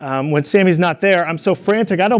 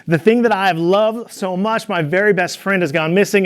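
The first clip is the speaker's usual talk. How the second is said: ordinary talk, nothing marked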